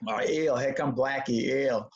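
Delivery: lightly voiced schoolyard bully, sneering; mocking taunt. Classmates teasing him in the schoolyard, a light mocking sneer.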